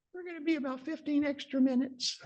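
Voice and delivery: high, gentle imitated surgeon's question; Dick Kirby's voice. Surgeon Dick asking his awake patient mid-scope, high imitated voice. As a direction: ask it in the colleague's high voice. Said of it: "He kinda talks like this".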